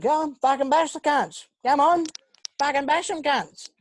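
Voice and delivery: high, loud goading shout; mock egging-on yell. Friend retelling Billy at the kitchen fight, goading yell. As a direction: egg on a brawl, imitating a rowdy mate.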